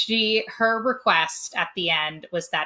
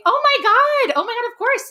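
high, loud, gushing excitement; thrilled fan watching TV; excited; 7 dB louder than usual. Retold or imitated. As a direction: squeal it like you can't believe it. Reliving watching daughter's call.

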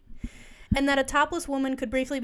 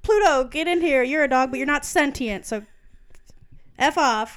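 mock [name] bossing; 3 semitones higher, 7 dB louder than usual. [name] ordering Pluto into the room, in a lightly voiced bossy tone. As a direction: order the dog around, half laughing. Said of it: "And then they're like".

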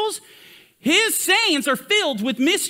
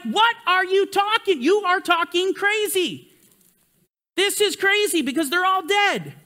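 higher, scoffing disbelief; mock them calling it crazy, pitched up; 7 semitones higher than usual. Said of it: "and they're like".

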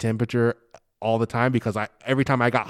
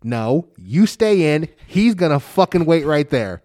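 firm; 4 semitones higher, 6 dB louder than usual. Retold or imitated. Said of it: "I was like".

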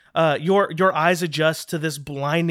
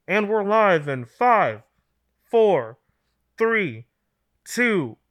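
lightly voiced offstage announcer counting down; announcer countdown; 4 semitones higher, slower than usual. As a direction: count them in like a live TV voice. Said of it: "this ethereal voice shout".

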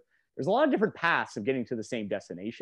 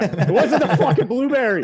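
loud, high mock Boston accent; comic Boston-accented outburst through laughter; 10 semitones higher, 10 dB louder than usual. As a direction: shout it in a hammed-up Boston accent. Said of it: "every time I do a Boston accent".

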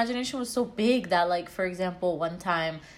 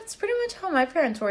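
ordinary talk, nothing marked

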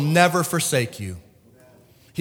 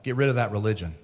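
low, unbothered imitation of the Holy Spirit; cool, casual; 8 semitones lower, 4 dB quieter, faster than usual. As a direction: say it coolly, low and easy. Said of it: "as cool as can be".

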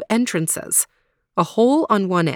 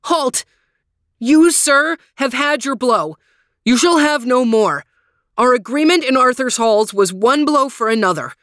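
much higher, louder commanding cry; crying halt; 4 semitones higher, 4 dB louder than usual. Acted. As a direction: order him to stop at once. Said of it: "and cried".

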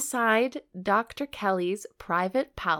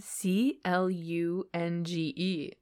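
spelled out slowly; 3 semitones lower, 5 dB quieter, slower than usual. Shown as a demonstration. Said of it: "What does that mean?".

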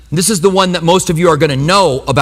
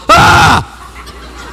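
startled yelp; 11 semitones higher than usual. Retold or imitated. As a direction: yelp like a jump scare. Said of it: "And my body goes".

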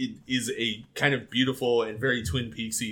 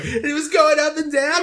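high, loud mocking voice; Shelley; 6 dB louder than usual. Podcaster acts out waitress mocking Leland on the casket. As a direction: poke fun in a raised, put-on voice. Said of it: "Shelley in the diner making fun of Leland".

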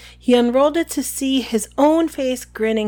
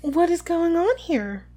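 put-on high, slow girlish voice; slow, sugary girlish question; falsely sweet; 7 semitones higher than usual. A narrator's reading. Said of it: "said a falsely sweet, girlish voice".

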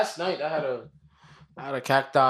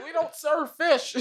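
mock cashier voice; slower than usual. Acting a Wendy's cashier.

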